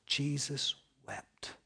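hushed whisper, very slow; whispered verse. Shown as a demonstration. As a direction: breathe the words out in awe. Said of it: "Shortest verse in the Bible".

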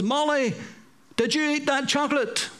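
stern mother. Retold or imitated. Mother questions child in story.

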